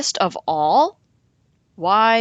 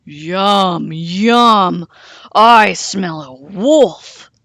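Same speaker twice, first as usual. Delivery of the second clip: loud, slow monster voice; monster bellow; 9 dB louder, much slower than usual. A narrator's reading. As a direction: bellow it like a huge beast. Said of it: "a deep voice bellowed".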